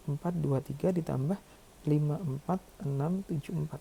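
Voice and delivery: ordinary talk, nothing marked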